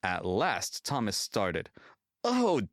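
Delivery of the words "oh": high, loud yawning groan; sleepy engine yawning high and loud. A narrator's reading. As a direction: drag it out as if just waking. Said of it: "he yawned".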